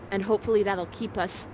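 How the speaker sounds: usual speaking voice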